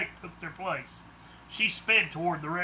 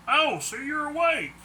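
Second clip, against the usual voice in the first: a lightly raised, higher creature voice; the hairy mass speaks up, a touch higher; Claude's voice; 9 semitones higher, 3 dB louder than usual. A narrator's reading. Creature greets the waking Harold, lightly voiced higher. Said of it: "it said in Claude's voice".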